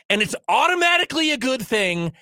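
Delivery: ordinary delivery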